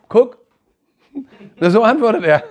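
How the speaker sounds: high, loud laughing exclamation. Real, not acted. Preacher telling the congregation how God answers, loud laughing. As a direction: laugh out the punchline.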